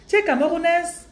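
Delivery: high, loud mock Gabonese accent; hammed-up Gabonese French question. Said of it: "Je fais l'accent gabonais".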